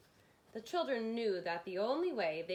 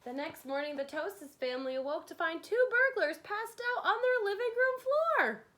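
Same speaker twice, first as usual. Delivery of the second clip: rising, louder and much higher; excited; 10 semitones higher, 6 dB louder, faster than usual. Acted. Narrator to kids, building to a high excited reveal. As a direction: build up to a thrilled peak.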